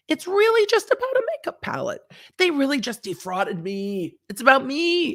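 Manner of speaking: high-pitched mock complaint; Swanson. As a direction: play a self-centred plaintiff protesting. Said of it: "it's really that I, Swanson".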